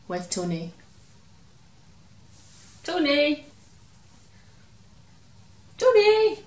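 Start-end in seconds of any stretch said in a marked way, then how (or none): 2.8-3.5 raised call across the room. Acted. Teacher looking for toy Tony, raised call. 5.8-6.4 much higher and louder calling shout. Acted. Teacher still can't find Tony, loud high shout.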